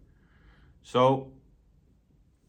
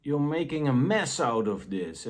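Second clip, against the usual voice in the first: harsh critical voice; 5 semitones higher, 3 dB louder than usual. Retold or imitated. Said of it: "the harsh critical voice".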